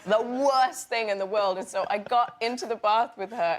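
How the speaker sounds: usual speaking voice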